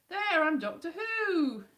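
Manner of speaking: loud, drawn-out character announcement; mock Jodie Whittaker. Vlogger in a wig playing Doctor Who, hammed-up. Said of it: "I could be Jodie Whittaker".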